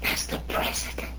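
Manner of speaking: acted frightened whisper, very soft; scared whisper. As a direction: whisper it as if afraid to be heard. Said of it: "says I, like this, you know, scared".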